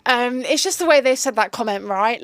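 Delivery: ordinary talk, nothing marked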